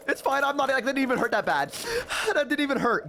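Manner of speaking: high, rushed mock-brave whimper; holding back tears, acted. Elf who face planted to onlookers, fighting tears in mock bravado. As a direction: insist you're okay, voice squeezed up high. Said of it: "very obviously trying to hold back tears".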